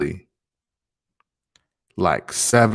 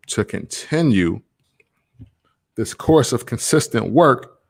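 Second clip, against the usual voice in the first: ordinary talk, nothing marked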